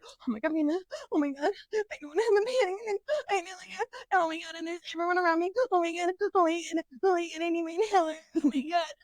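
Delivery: fast, high, breathless mock panic; imitating a panic attack. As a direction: rattle it off like you're panicking. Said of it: "exactly what the fuck I sound like when I'm having a panic attack".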